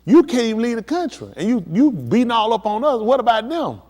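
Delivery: people complaining to their leader. Retold or imitated. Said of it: "They like".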